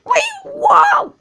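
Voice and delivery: very high shrieking refusal, loud; screaming trucks. Acted. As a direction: shriek it back defiantly. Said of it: "screamed the trucks".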